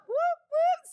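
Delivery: high, loud celebratory whoop; real delighted cheer; excited whoop. Real, not acted. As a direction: cheer them in like a hype host. Greeting viewers joining livestream.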